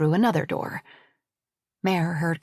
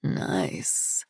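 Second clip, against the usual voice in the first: low, gravelly, barely voiced; gravelly murmur, almost unvoiced; 6 dB quieter than usual. A narrator's reading. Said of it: "he said in a low, gravelly voice".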